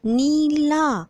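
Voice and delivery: "नीला" drawn out very slowly, leaning on the long नी; stressed long ई. Shown as a demonstration. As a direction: stretch both vowels of "नीला" for the class. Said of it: "जोर दे के बोली जा रही है". ("नीला" में बड़ी ई पर ज़ोर देकर खींचें)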